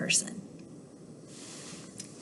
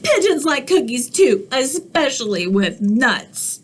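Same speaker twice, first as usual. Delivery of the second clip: ordinary delivery, nothing marked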